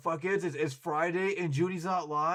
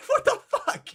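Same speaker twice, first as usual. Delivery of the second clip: laughing; 9 dB louder than usual. Real, not acted. Bursts out laughing at chat.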